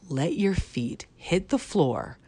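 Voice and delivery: ordinary delivery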